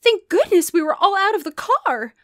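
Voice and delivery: high, loud relieved cry; mom's cry. A narrator's reading. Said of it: "Mom cried".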